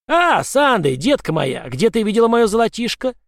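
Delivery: eager leprechaun. Acted. Leprechaun greets girl eagerly.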